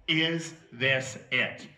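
ordinary talk

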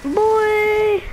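high, drawn-out cry of excitement; excited high cry. Real, not acted. Calling out during the hunt.

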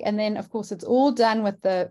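normal talking voice

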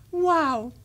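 high, loud wow of amazement; bewonderende schreeuw. A narrator's reading. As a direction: shout it out in wonder. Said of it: "zo luid dat alle dieren". (luid en hoog uitroepen)

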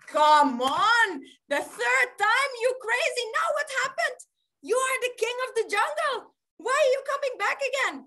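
high-pitched, incredulous scolding; fed up, demand an explanation; exasperated yogi. Acted. Yogi to the tiger back a third time, exasperated.

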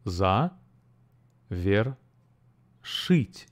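За, вер, шить split into separate syllables with pauses. Shown as a demonstration. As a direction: break завершить into За, вер, шить. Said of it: "has three syllables".

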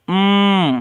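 "উম" as a long closed-lip mmm through the nose; nasal M sound. Shown as a demonstration. Teacher showing learners the M sound, a held nasal hum. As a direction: hum "উম" with no vowel in it. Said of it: "কি sound?".

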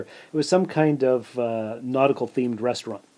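ordinary talk, nothing marked

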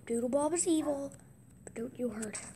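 normal talking voice

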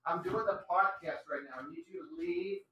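hushed aside. Real, not acted. Host quietly asks his wife to leave the podcast room. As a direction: keep it down, she's not meant to be on the recording.

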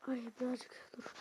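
ordinary delivery

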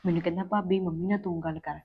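soft, secretive telling; hushed voice. Acted. Wife secretly tells husband about the match, hushed voice. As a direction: confide it softly, keeping it secret. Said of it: "ਹੌਲੀ ਬੋਲੋ".

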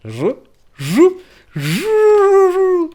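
very high, loud excited buzzing; astonished buzz. A narrator's reading. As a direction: bounce the buzz in wonder. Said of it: "З подиву Жук застрибав". (жук стрибає з подиву)

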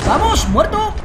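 high, loud gloating yell; triumphant shout. Real, not acted. Gamer taunting the dead enemy, loud gloat. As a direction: crow over the kill. Kill in game.

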